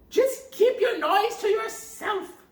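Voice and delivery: high loud witch snap; old witch scolds Gretel for weeping; scolding witch. Acted. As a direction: hush the crying girl harshly. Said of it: "said the old woman".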